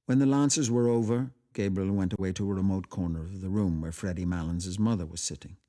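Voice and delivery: plain reading voice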